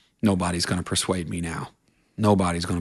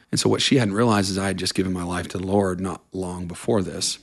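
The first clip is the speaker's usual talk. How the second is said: normal talking voice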